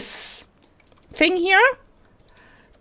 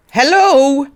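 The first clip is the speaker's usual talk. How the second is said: bright, louder and higher hello; excited hello; 8 semitones higher, 7 dB louder than usual. Real, not acted. Player greeting a new creature, excited hello. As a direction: call out a happy hello to the creature.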